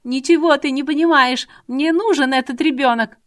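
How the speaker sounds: high, loud frantic screaming; a hysterical woman screaming at the negotiator; hysterical shout. Acted. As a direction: scream it out of control.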